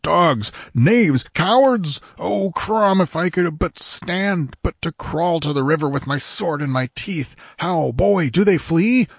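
indignant warrior, insults burst out one by one; panting outrage. A narrator's reading. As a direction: rail helplessly at the deserters. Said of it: "panted Conan".

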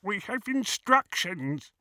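high, slow goblin voice; deferential goblin. A narrator's reading. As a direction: object slowly in a high goblin voice. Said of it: "he said with a bow".

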